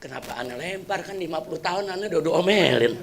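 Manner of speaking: soft, sorrowful plea to God; a quiet, heartbroken sigh to God; saddened husband quietly laments to God; sad lament. Retold or imitated. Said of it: "hatinya sedih".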